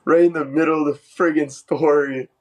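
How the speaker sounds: frustrated. Real, not acted. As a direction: grumble at an untimely interruption. Storytelling interrupted, swears in frustration.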